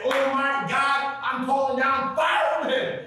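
high, drawn-out prophetic invocation; dramatic calling out. Acted. Acting Elijah calling down fire.

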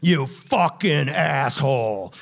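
loud, raging mobster yelling; angry gangster shouting down the phone at the reporter; furious shout. Retold or imitated. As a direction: bellow every curse in fury.

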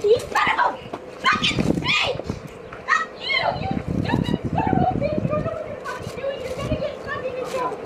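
arrested woman shrieking curses at police, furious; furious screaming. Real, not acted. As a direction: shriek the insults, then spit the rest.

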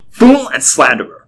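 high, slow, loud denunciation; loud rebuke. A narrator's reading. Clerk rebukes the insolent entrant, loud and angry. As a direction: denounce loudly, drawing out the words. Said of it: "He spoke loudly and rebuked".

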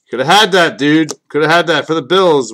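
needling a buddy who passed on the team; loud taunt. Real, not acted. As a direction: rub it in, loud and gleeful.

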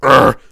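loud, low "urgh"; deep growled grunt. Shown as a demonstration. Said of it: "a deeper voice where it's just like".

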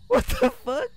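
high, stunned disbelief. Real, not acted. Shocked by glitch in game.

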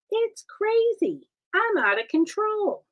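high, drawn-out playful wail; mock hysteria. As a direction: exaggerate it in a high, swooping voice. Joking about going live daily.

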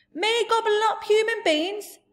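high, loud protest; indignant giant outburst; outraged giant shout. Acted.

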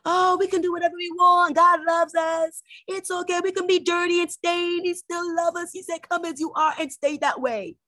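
high, singsong mock voice of complacent believers; mocking lax churchgoers. Preacher parodying worldly believers who reject preaching. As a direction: parody them sweetly, almost sneering. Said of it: "People just don't want you to preach".

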